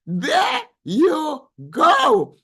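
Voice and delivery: slow and high, stretching every word; a stretched-out echo of the cheer; drawn-out sing-song. Real, not acted. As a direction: savour it, slowly and teasingly.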